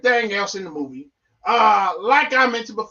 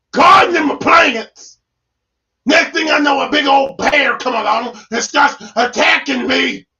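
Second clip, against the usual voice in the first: high, loud indignant shouting; worked-up, wailing protest; venting outburst; 6 semitones higher, 10 dB louder than usual. Pretend. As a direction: yell it out in hammed-up outrage. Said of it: "had to get it out my chest".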